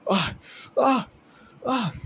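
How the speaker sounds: series of high, loud yelps; acted high-pitched screams; pained cries. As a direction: cry out in sharp bursts of pain. Creature cries out after being cut.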